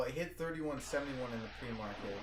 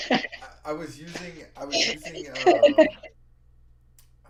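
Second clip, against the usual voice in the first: loud words through laughter; half-laughing, drawn-out words; 5 semitones higher, 16 dB louder, slower than usual. Real, not acted. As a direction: still laughing as he explains. Still laughing, talking loudly.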